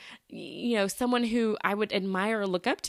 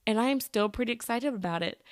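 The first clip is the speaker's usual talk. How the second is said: ordinary delivery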